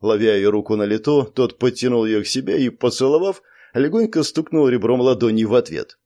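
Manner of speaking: plain reading voice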